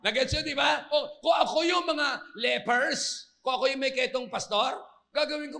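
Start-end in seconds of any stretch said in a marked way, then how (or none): none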